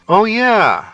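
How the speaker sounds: high-pitched swoop of remembering; as if it just came back to you; a lifted, dawning realization; sudden recollection. A narrator's reading. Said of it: "recollecting what a quirk was".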